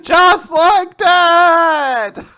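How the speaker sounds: very slow, high and loud hammed-up echo; mock wail. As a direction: drag it out in mock grief. Mocking the line.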